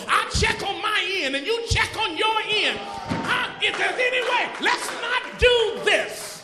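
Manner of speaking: loud pleading prayer, slowing on the last words; Jesus pleading. Retold or imitated. Jesus in the garden pleading with God, loud and slowing. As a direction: beg the Father to call it off. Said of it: "go to his father and say".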